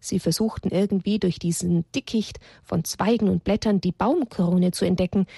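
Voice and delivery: usual speaking voice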